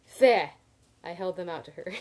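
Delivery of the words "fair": "Fair" blurted far louder and higher as a spit; loud spitting sound. Shown as a demonstration. Reader redoing the spit sound after checking, loud and sharp. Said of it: "a sound and not a name".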